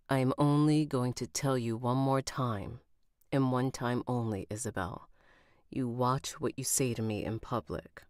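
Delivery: stern man's warning. Acted. Man warning Isabel about public remarks, stern and low. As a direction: warn her in a low, contained voice.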